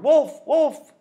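high, loud repeated cry; hollering. Acted. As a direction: holler for the farmers. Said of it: "She hollered".

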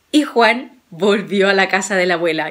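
ordinary delivery, nothing marked

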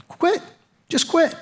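high, soft little voice; little tempting voice. Acted. Little voice urging surrender during a marriage argument, small and high. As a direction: imitate a small inner voice, lightly and high-pitched. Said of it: "there's this little voice that says".

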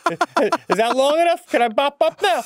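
lampoon a bad actor waiting for his cue; mocking Tom Cruise. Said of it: "put your head down and go".

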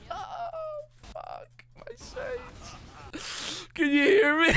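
wheezing high through helpless laughter; can barely get words out for laughing; crying with laughter. Real, not acted. Streamer whose camera froze mid dance, crying with laughter. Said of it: "I'm fucking crying".

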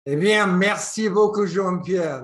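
French spoken with an English accent; hearty French thanks in an English accent. Real, not acted. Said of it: "I say my French with my English accent".

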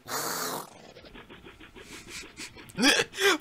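breathless airy laughing; silent wheezing laugh. Real, not acted. Reacting to the dance.

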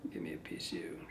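breathy whisper to himself; hushed under-breath mutter; whispered aside. Real, not acted. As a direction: whisper it to the feather as you work.

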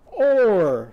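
loud high Or like a command; bossy order. Shown as a demonstration. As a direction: point and order Or. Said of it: "and, and go".

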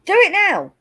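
loud, insistent demand. Real, not acted. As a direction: insist loudly, no arguing. Demanding testicle check.

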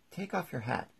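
lightly voiced king giving a command. A narrator's reading. King orders the Hatter.

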